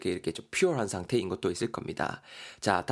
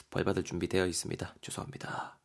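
whisper; 4 semitones lower, 5 dB quieter than usual. Acted. Acting ashamed B's apology.

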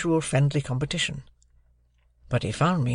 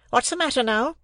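high-pitched, smartly snapped question; smart demand; 11 semitones higher than usual. A narrator's reading. Sister questioning Joe at tea, smartly. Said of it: "she said smartly".

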